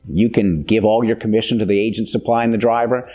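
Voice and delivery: normal talking voice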